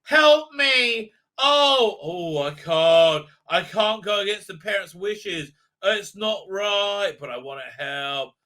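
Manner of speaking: hammed-up plea for help, sinking; mock pleading. Mimicking reluctant helpers in a story, mock pleading. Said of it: "this is how they said".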